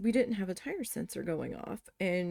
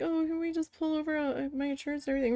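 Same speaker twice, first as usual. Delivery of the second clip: high, rushed pleading imitation; upset woman; 7 semitones higher, faster than usual. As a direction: mimic her panicked plea, fast and high. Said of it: "she was so upset".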